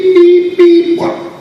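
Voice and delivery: high, loud mimicked beeps; imitated studio beeps. Studio starts the take without discussion, imitated high beeps. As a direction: mimic electronic cue beeps loudly.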